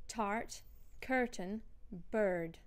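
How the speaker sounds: "tart, curtain, bird" with every R pronounced; rhotic-accent "tart, curtain, bird"; rhotic before consonants. Shown as a demonstration. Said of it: "the R before consonants would be".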